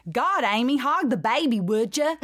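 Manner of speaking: put-on childhood self with a thick drawl; young Southern drawl. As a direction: scold a sister in a kid's drawl. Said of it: "seven or eight-year-old [name] that used to talk like this".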